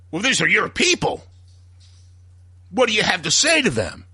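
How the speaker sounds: high-pitched pressing challenge; hammed-up reporter pushing for an answer; mock accusing interviewer. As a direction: corner him with the question. Stahl pressing Trump on air.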